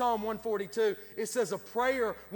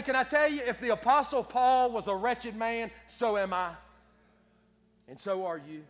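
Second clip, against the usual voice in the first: ordinary delivery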